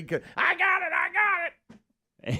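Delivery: hammed-up snake attack yell; mock panic. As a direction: yelp like the snake just got you. Pretending a snake bit him.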